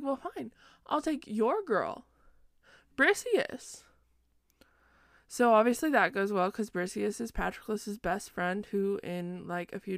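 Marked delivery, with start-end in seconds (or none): 0.0-3.8 higher-pitched mock spite, rising on the name; mock petulant retort. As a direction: imitate a petty comeback, pitched up. Retold spiteful retort in quarrel.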